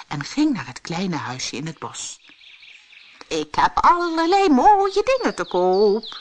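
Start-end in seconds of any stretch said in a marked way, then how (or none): 3.3-6.2 high, loud sales cry; high-pitched, projected hawker's call; pedlar calling out. A narrator's reading. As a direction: call out your wares as a disguised saleswoman. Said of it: "riep ze".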